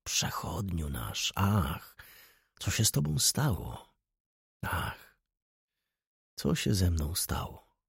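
soft, mournful lament; hushed, sorrowful questioning; plaintive. A narrator's reading. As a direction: sigh it out quietly, like voices in the leaves. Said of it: "zlewały się w jeden głos żałosny".

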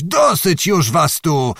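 put-on old man shouting at the crowd from the ferry; yelling. A narrator's reading. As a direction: roar them back off the ferry. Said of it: "zaraz począł znowu wrzeszczeć".

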